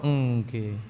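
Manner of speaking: low soft timid yes; meek yes. Acted. Sinner meekly saying yes to Allah, low and soft. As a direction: murmur a cowed yes.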